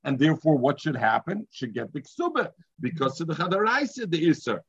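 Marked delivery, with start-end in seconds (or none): none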